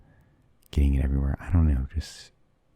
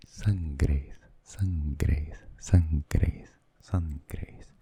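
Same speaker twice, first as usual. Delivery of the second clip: soft whispered ASMR repetition; 4 dB quieter, much slower than usual. Shown as a demonstration. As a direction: breathe the words out like an ASMR trigger. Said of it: "Maybe it's a good A S M R word".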